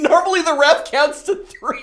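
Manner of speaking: high, loud protest; loud laughing complaint; excited protest. Real, not acted. Protesting thumb war loss.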